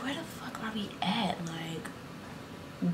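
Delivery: hushed, voiceless aside; retold whisper. Friends following him on a long drive, puzzled whisper. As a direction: whisper it as if unsure where you are.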